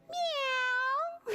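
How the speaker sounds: very high, drawn-out meow; cat's meow. A narrator's reading. Little bird trying again for his song, high meowing. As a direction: mew it high and long. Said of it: "and he says".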